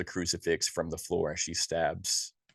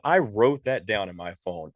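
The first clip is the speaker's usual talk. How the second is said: usual speaking voice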